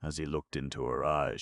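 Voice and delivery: plain reading voice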